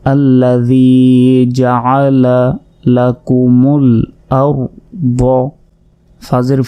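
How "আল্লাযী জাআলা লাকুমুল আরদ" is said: slightly chanted reading of the phrase; recitation read. Shown as a demonstration. Teacher reads the joined phrase aloud, recited. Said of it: "reads the phrase joined".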